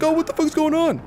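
high, mock-bewildered voice; imitating startled enemies. Gamer imitating enemies caught by the charge, high mock surprise. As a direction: squeal it like a baffled enemy. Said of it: "they're like".

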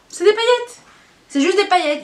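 high, loud protest; indignant, pitch and volume shooting up; exasperated outburst. Real, not acted. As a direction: blurt it out, loudly appalled. Outraged that it is only glitter.

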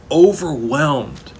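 Overwhelmed drawn out slowly and stressed; slow emphasis. Shown as a demonstration. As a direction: let the word Overwhelmed sink in slowly. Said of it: "Oh my goodness".

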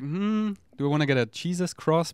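high, musing hum into a question; lilting, wondering aloud; pondering. Retold or imitated. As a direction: re-enact weighing up a purchase. Acts out pondering in the car.

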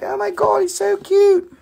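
high, gushing squeal. Retold or imitated. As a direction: coo over a cute puppy. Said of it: "You guys will be like".

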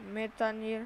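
ordinary talk

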